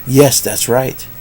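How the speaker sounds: usual speaking voice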